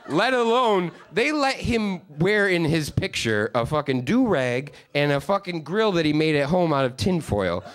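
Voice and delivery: ordinary talk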